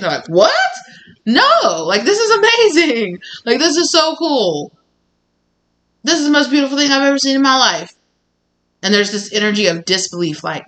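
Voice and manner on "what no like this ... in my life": high, loud laughing gush; excited wonder, pitched high and louder; amazed delight. Retold or imitated. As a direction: burst out in disbelieving joy. Partner awed, laughing.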